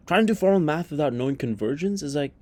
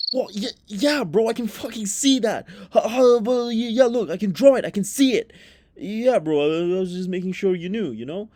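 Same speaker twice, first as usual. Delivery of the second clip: flustered defensive student; 5 semitones higher than usual. Pretend. Student caught not knowing, flustered high bluster. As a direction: cover embarrassment with bravado.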